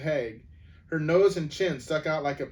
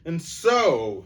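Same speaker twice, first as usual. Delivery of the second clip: louder, higher dwarf's rising cry; rising to a scream; 5 semitones higher, 7 dB louder than usual. A narrator's reading. Nickabrick threatening the council, rising toward a scream. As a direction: let the voice climb toward a scream. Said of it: "whose voice now rose to a scream".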